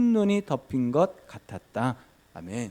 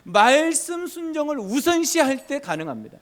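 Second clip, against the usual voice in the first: ordinary talk, nothing marked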